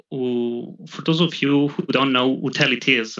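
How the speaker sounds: usual speaking voice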